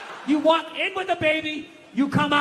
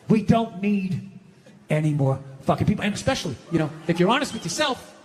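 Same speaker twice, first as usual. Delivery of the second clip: low deadpan; 10 semitones lower than usual. Acted. Drops low for punchline.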